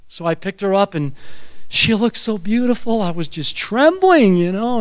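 ordinary talk, nothing marked

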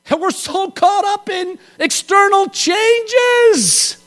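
high, slow, ringing cry; preaching shout. Real, not acted. Preacher crying out to his congregation about external changes, slow high shout.

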